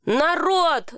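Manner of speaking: high-pitched call to stop a squabble; restrained narrated holler; mild yell. A narrator's reading. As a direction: call out over them without full volume. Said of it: "заорал я".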